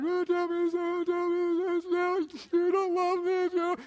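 high, whiny mock sobbing; mock crying bride. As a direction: play a weepy bride who wheedles. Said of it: "she cried".

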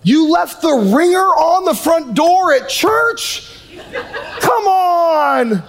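high, drawn-out comic complaint; mock exasperation. Mock exasperation at loud weeper.

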